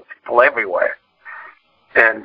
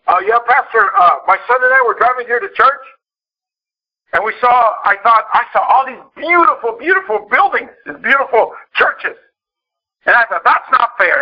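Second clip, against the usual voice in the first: excited dad; 12 semitones higher than usual. Retold or imitated. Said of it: "One day he's like".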